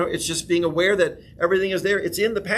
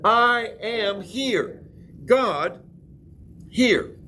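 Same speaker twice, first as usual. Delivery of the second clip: slow solemn declaration; 5 semitones higher, much slower than usual. Shown as a demonstration. Pastor asks the room to repeat it, slow solemn declaration. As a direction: stretch each word slowly, solemnly. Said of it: "Say it again".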